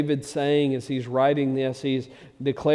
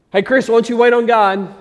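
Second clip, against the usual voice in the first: loud, higher-pitched chirpy call; mock pep talk; 9 semitones higher, 12 dB louder than usual. Friend tossing glib advice to a struggling man, loud mock pep.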